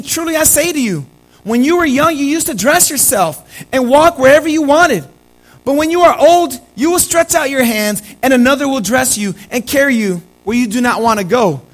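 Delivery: loud, high scripture proclamation; scripture read with force. Acted. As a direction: declare the verse to the church.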